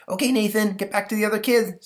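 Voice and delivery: put-on high, loud kid orders; bossy boy. Stanley directing Nathan back, high kid voice. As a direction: send him off.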